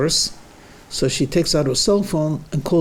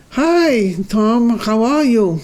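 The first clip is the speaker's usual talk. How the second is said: higher and louder, a cheery mom; mother's voice; 7 semitones higher, 3 dB louder, slower than usual. Acted. Mother greeting her son on the phone, higher and louder. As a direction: greet him sweetly like his mother.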